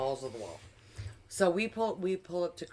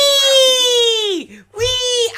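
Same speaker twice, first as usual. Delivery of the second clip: high, loud "Wee!" down the hill; hammed-up joyful shriek; mock gleeful squeal; 17 dB louder than usual. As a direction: squeal it like a kid on a slide. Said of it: "He goes".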